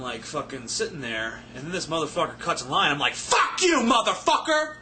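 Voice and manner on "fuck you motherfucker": high, loud angry yell; aggressive swearing. Shown as a demonstration. Cursing out a man who cuts in line, angry yell. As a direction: snap it at the line cutter. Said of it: "same impact there".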